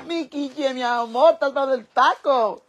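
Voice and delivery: high-pitched fond gushing; cooing pet talk. Real, not acted. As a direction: coo a question at a beloved pet. Addressing pet with endearments.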